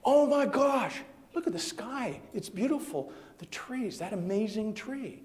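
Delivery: gaze around in hushed amazement; awestruck Adam. Acted. Adam awed after biting apple.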